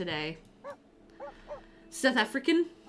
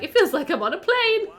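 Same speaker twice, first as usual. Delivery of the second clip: high, lifted mock-colleague voice; imitated colleague's tease; 10 semitones higher, 5 dB louder than usual. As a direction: quote a giddy colleague, louder and higher. Mimicking colleagues' reaction, laughing.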